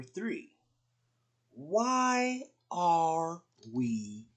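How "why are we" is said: slow dramatic. Shown as a demonstration. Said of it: "Write it down".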